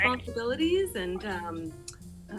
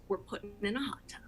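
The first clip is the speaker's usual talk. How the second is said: whispering; 5 semitones lower, 9 dB quieter, faster than usual. Acted. Secret plan revealed.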